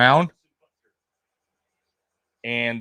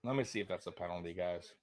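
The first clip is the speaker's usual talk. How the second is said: ordinary talk, nothing marked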